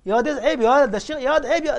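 put-on loud, high, scolding the boy; scolding shout. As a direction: shout him down for shaming the sheikh. (المصلون يوبخون الولد بصوت عالٍ غاضب)

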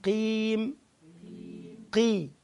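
قاف من الحلق; deep qaf. Shown as a demonstration. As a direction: say قي from the throat, not the mouth. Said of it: "قاف بتيجي من الحلق".

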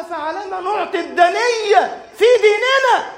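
high, pained demand, falling at ديننا; a cry of wounded pride; indignant cry. Acted. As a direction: Umar pressing the Prophet, high and indignant. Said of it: "غاضبا".

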